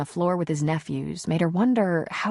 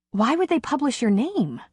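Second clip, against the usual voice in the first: tinge of anger; 8 semitones higher than usual. Acted. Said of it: "she asked with a tinge of anger".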